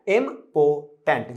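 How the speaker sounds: "Important" very slowly, im... po... tent; broken into syllables. Shown as a demonstration. Teacher showing students what to do, "important" split im-po-tent. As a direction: pause between the three syllables of "important". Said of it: "So, क्या करना है".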